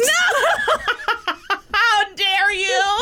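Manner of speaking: laughing outrage. Real, not acted. Mock outrage over Bluey joke.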